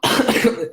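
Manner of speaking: short sharp cough from a cold; sick cough. Real, not acted. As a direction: cough once, hard. Sick speaker coughing mid-vlog.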